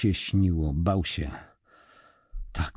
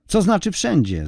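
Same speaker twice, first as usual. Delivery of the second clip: impatient; 11 semitones higher, 4 dB louder than usual. A narrator's reading. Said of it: "zniecierpliwionym głosem".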